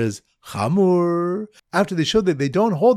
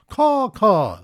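high, loud cawing call; bird caller caw; 5 semitones higher, 3 dB louder than usual. Retold or imitated. Teacher imitating a bird caller for the mnemonic, loud high caw. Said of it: "a bird caller going".

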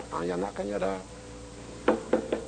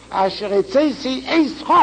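אתך punched at a higher pitch; stress on אתך; 6 semitones higher, much faster than usual. Shown as a demonstration. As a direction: lift and hit the final pronoun אתך. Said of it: "upon the pronoun of Eischa".

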